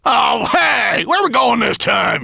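high, loud indignant shout; cross call. A narrator's reading. Wet dwarf as master changes direction, calling crossly. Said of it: "he called crossly".

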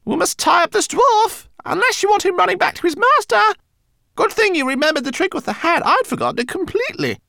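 high, businesslike rodent voice; brisk rat. A narrator's reading. Rat organising the tying up of the dwarf, brisk. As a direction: give orders in a high voice. Rat instructs her companion.

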